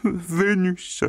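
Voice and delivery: high, sheepish whine; the pig whining his answer; whimpering pig. A narrator's reading. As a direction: answer meekly. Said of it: "répondit Pleurnichard".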